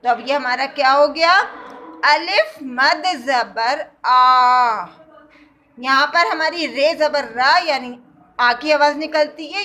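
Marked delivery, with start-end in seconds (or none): 2.1-4.8 آ held for two beats, slow and full; slow teaching demo of a long آ; stretched long aa. Shown as a demonstration. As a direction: draw out آ like two alifs. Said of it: "دو الف کے برابر ہم الف کو پڑھتے ہیں".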